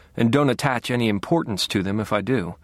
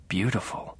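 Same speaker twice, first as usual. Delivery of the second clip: hushed, almost unvoiced; breathy whisper; 8 dB quieter than usual. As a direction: breathe it in quiet awe. Quiet awed realization.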